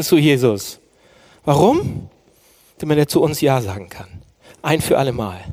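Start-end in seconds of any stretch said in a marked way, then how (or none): none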